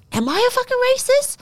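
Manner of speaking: high, squeaky mock disbelief; incredulous self-question. Replaying her panicked question to herself, high disbelief. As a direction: ask yourself in shocked disbelief, voice jumping way up. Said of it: "I was like".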